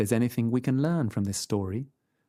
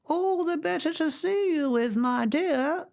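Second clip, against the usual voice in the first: much higher croaky fake granny; croaky grandmother voice. A narrator's reading. Wolf in bed faking grandmother, high and croaky.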